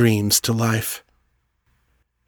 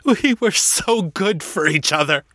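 tearful, pitched higher, breaking; grieving rival, tearful and higher; voice thick with tears; 10 semitones higher than usual. Acted. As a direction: mourn him through tears. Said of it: "his voice thick with tears".

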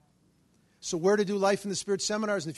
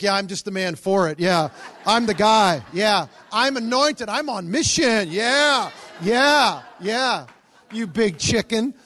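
loud, swelling self-congratulation; hammed-up swagger, building louder and slower; mock triumphant boasting; 3 semitones higher, 9 dB louder, slower than usual. Visitor feeling great after her confession, mock triumphant boasting. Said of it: "Now he's feeling great".

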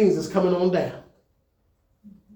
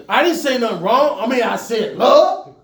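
louder, indignant protest of innocence; husband defending himself to his wife, louder and aggrieved; 4 semitones higher, 6 dB louder than usual. Retold or imitated. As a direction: insist you said nothing wrong. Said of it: "and I'd be like".

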